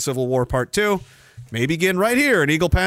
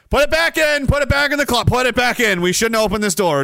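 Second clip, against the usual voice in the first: mock panic; 7 semitones higher, 5 dB louder than usual. Panicked about opening closet door.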